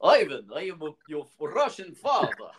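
mock Russian accent; Russell Crowe's Russian accent. Playing Kraven's dad for laughs, weird Russian accent. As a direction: put on a hammy Russian accent. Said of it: "a weird Russian accent".

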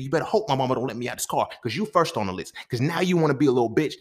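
loud, high-pitched furious tirade; spazzing out. Retold or imitated. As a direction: go off on him, retelling it at full heat. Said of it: "And I'm spazzing out, going off".

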